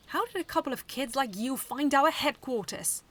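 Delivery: loud furious interrogation; angry demand. Acted. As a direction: demand answers from the kids, furious. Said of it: "Seaslug demanded, very angry".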